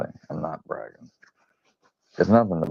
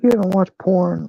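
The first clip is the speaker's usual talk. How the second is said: incredulous friend; 11 semitones higher than usual. Retold or imitated. Imitating his friend's questions.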